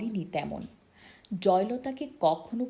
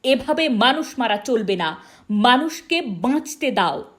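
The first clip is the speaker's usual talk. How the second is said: loud, high-pitched demand; protest rally cry; 4 semitones higher, 11 dB louder than usual. Acted. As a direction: cry it out over a marching crowd. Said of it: "মিছিলে হাত উঠিয়ে বলেছিল".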